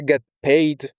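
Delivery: ordinary talk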